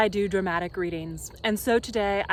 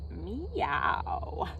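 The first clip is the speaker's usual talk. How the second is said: lightly voiced cat; 6 dB quieter than usual. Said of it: "Katie stares and says".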